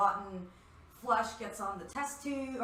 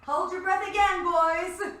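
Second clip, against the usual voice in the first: high, loud heads-up to the team; calling out; 8 semitones higher, 10 dB louder, slower than usual. Acted. Midnight warns the boys before tearing her outfit, loud call. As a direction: sing out a warning to everyone.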